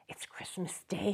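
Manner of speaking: whispered in wonder; to himself. Acted. Scrooge whispering to himself in wonder. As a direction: breathe it to yourself. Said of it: "said Scrooge to himself".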